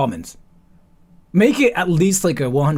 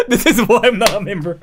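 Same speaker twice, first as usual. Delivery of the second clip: laughing; 8 semitones higher, 5 dB louder than usual. Real, not acted. Laughing at own stunt.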